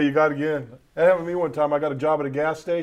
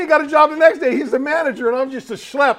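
laughing; 10 semitones higher, 5 dB louder than usual. Real, not acted. Laughing at his own story.

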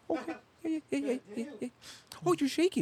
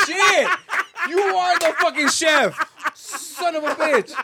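high, loud laughing outburst; excited teasing; 7 semitones higher, 4 dB louder, slower than usual. Real, not acted. Teasing a friend as the chef, high and excited. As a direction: burst out in delighted mockery. Excited joke about cook.

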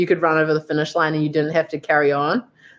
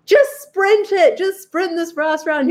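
much higher, slow mock coach urging; imitating Matt coaching; 11 semitones higher, slower than usual. Imitating Matt urging her to sprint the last round, high mock coach voice. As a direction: egg her on like a coach at the finish. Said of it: "he's like".

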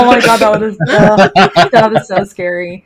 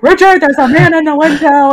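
alarmed; 8 semitones higher, 5 dB louder than usual. Retold or imitated. Waking husband in alarm.

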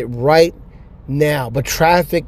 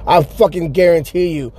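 ordinary delivery

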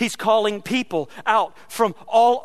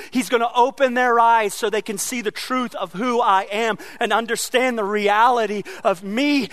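ordinary talk, nothing marked

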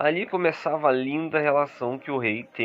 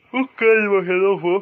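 high, loud, happy outburst; excited; 6 semitones higher, 6 dB louder than usual. Acted. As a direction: cheer the news out. Celebrates a contract renewal.

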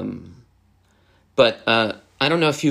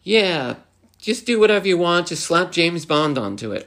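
dismissive exec impression; 7 semitones higher than usual. Imitating a careless game decision-maker.

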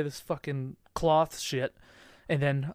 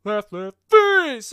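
rising shout, louder on face; shouted drill command; 5 semitones higher, 9 dB louder, much slower than usual. Retold or imitated. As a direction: bark the facing command. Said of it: "he was like".

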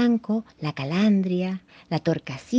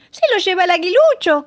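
high, loud warning cry; thrush shouting; 13 semitones higher, 8 dB louder than usual. A narrator's reading. Thrush yelling from the branches, high alarmed shout. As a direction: yell it after the eaglet. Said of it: "gritaba el tordo".

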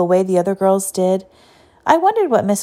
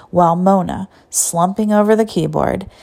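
ordinary delivery, nothing marked

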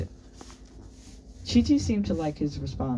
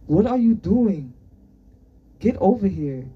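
put-on loud, higher American-accented summons; 5 semitones higher, 8 dB louder than usual. A narrator's reading. Irritated teacher calls the boy over, loud American accent. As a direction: call out impatiently in an American accent. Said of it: "he said in his American accent".

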